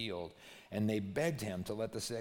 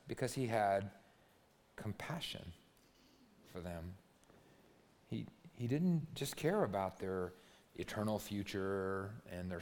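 soft preaching; 3 semitones lower, 5 dB quieter than usual. Real, not acted. Quiet intimate preaching close.

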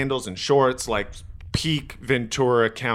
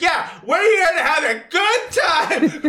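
slow, very high, loud mock woman with a laugh; mocking the girlfriend; 4 dB louder, slower than usual. Girlfriend backing up the big guy, very high mock voice. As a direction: drawl it like the smug girlfriend. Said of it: "the girl's like".

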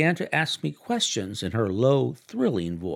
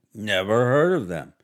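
curt, louder dismissal; a lightly voiced arrogant husband; decisive; 5 dB louder than usual. A narrator's reading. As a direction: brush the firm off flatly. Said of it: "he remarked decisively".

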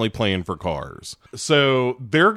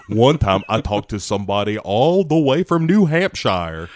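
hammed-up Southern accent; mock Alabama accent. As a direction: play a naive Alabama boy. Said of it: "It is the accent that delivers the dialogue".

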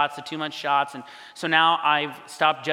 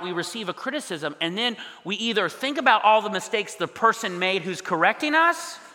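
usual speaking voice

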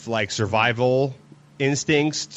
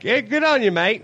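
much higher mock Aussie dad; cheery Australian thanks, hammed up; Australian father; 8 semitones higher than usual. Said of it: "father of the groom ... was like".

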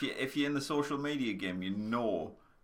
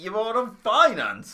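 incredulous shout; 10 semitones higher, 9 dB louder than usual. Real, not acted.

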